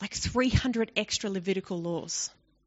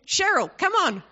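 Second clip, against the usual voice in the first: calling a sheep; 9 semitones higher, 3 dB louder than usual. Pretend. Shepherd calling his sheep.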